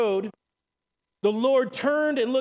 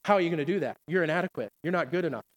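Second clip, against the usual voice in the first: low, soft, taunting whisper of the tempter; preacher voicing the devil's soft low taunt; the enemy's taunting voice; 5 semitones lower, 3 dB quieter, much faster than usual. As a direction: drop low and quiet like the devil whispering doubt. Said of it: "He says".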